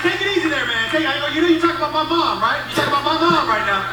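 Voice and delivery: acted-out indignant dad; indignant warning. Dad warns son about mom.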